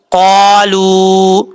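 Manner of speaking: قالو slowly chanted with the waw held for one alif; elongated recitation. Shown as a demonstration. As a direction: draw out قالو. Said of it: "ایک الف کی مقدار لمبا کر کے پڑھیں گے". (واو مدہ کو کھینچ کر)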